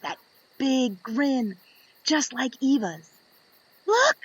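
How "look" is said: sudden high excited shout; a child's thrilled yell. Acted. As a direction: call out in delight. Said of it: "I yell".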